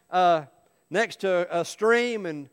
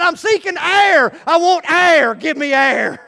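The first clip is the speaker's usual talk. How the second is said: high, loud cry for air; acted frantic plea, full volume; desperate sputtering shout; 9 semitones higher, 11 dB louder than usual. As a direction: yell it like a half-drowned man. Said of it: "he's spitting and sputtering".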